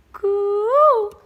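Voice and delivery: loud, high pigeon "coo"; loud high dove coo. Shown as a demonstration. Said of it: "I can".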